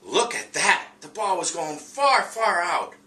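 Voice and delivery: high and loud amazement; excited dog. Acted. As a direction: marvel at the ball flying far. Teacher voices dog in read aloud.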